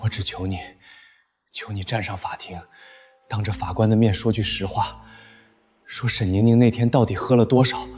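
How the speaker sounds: soft broken begging; quiet pleading. Acted. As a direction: plead quietly, almost gone. Begs him to testify.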